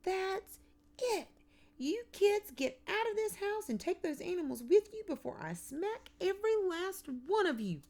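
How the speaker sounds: high, very loud screaming outburst; furious holler. A narrator's reading. Aunt throws kids out of house, hollering. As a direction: snap and holler at full pitch. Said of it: "she snaps and hollers".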